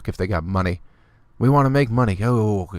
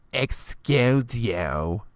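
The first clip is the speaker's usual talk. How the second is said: slow, drawn-out rising "Excuse you", quieter; softer snooty "excuse you"; 4 dB quieter, much slower than usual. Retold or imitated. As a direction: sneer it one last time, hushed. Last mock of the put-down.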